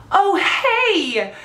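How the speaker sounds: high, surprised greeting acted out; delighted recognition. Idol recognizing a fan and greeting her, delighted. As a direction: imitate a thrilled double-take greeting.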